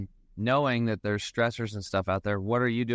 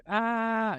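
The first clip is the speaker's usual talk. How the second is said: exasperated ah; 12 semitones higher than usual. Retold or imitated. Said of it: "And it's like".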